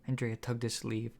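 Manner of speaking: ordinary delivery, nothing marked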